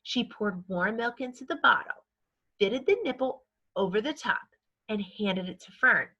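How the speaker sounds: ordinary delivery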